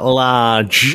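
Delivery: a lightly mimicked Manchester accent. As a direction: draw it out like a Mancunian rocker.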